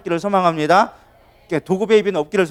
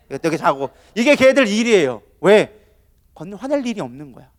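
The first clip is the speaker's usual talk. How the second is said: ordinary talk, nothing marked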